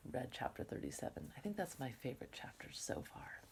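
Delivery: hushed confiding whisper. Real, not acted. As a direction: drop to a soft whisper. Confiding aside to class.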